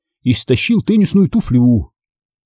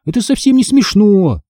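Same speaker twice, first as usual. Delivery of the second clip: high-pitched, offended protest; indignant, voice raised in pitch; 8 semitones higher than usual. A narrator's reading. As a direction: protest with mild outrage. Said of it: "возмутилась я".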